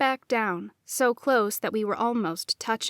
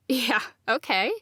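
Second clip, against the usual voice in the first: light scoff. A narrator's reading. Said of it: "I lightly scoffed".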